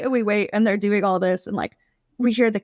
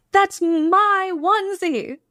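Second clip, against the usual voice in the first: indignant; 9 semitones higher than usual. Retold or imitated. Indignant wife confronting the intruder.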